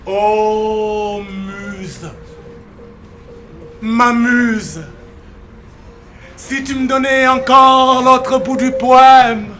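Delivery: swelling poetic cry; impassioned invocation. Acted. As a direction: call out to the muse at full force. Refrain returns at full force.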